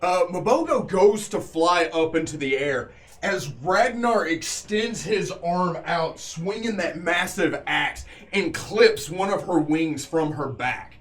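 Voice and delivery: dramatic battle narration, leaning on the big action words; game master narration. A narrator's reading. Game master telling players of the beast's fall, dramatic narration. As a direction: tell the killing blows like an epic tale.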